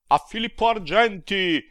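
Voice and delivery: high, loud jeering cry; crowd shout. A narrator's reading. The damned mocking Filippo, high loud jeering shout. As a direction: hurl the name out like a mob. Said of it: "Tutti gridavano".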